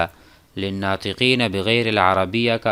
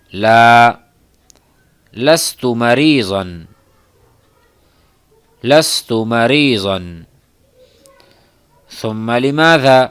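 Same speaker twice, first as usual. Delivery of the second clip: loud, deliberate classroom drill; read aloud; 2 semitones higher, 7 dB louder than usual. Shown as a demonstration. Teacher leading students in repeating Arabic phrases, loud drill. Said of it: "میرے ساتھ باواز بلند پڑھیے".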